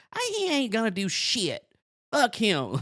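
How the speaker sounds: high, put-on boyish defiance; Turkey Feather. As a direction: talk back tough in a squeaky kid voice. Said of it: "looking and sounding like Bobby Hill".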